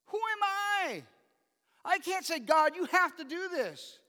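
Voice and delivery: full-force pulpit rebuke; fiery preaching. Acted. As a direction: drive the point home to the congregation.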